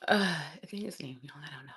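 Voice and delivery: very soft, half-whispered guess; deflated, under-the-breath uncertainty; unsure murmur. Real, not acted. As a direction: drop to a near whisper, giving up. Deflated guess after the outburst.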